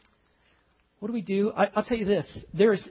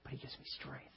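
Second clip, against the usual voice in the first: a soft whisper; 4 semitones lower, 20 dB quieter, faster than usual. Real, not acted. Preacher confiding God's strength, whispered to the congregation. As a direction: breathe it out like a secret. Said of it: "intimate confession".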